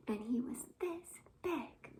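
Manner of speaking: whispered, very soft. Acted. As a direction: hush it like a secret. Surprise whisper in last verse.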